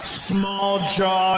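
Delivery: ordinary talk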